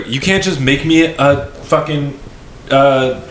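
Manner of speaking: exasperated pleading. Retold or imitated. Customer begging cashier to take payment, exasperated. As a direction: draw out the plea in disbelief.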